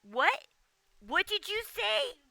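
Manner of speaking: high, slowed, stunned what did you say; retold shock. To the ex-member on Discord, high stunned disbelief. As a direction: act floored by what he said. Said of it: "And I was like".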